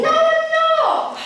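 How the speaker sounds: high, drawn-out denial; loud protest. Acted. Accused of teasing a friend, loudly protesting. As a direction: deny it loudly, stretching the words.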